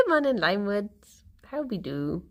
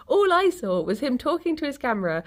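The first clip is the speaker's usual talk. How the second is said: usual speaking voice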